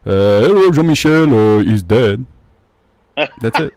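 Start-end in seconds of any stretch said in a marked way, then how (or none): 0.1-2.3 put-on French-accented dad on an answering machine; French dad voicemail. Son imitating dad's voicemail opening, French accent. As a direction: leave a message like a French father. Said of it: "It goes".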